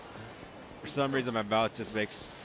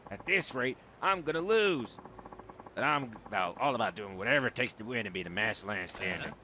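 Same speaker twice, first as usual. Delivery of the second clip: game character with swagger. Acted. Rival tells his opponent he'll win, emphatic and theatrical. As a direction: read it as a cornered, determined rival.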